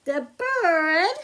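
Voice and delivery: put-on slow, lilting storybook read for kids; reading to children. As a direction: read it like story time for little ones. Reads picture book to children.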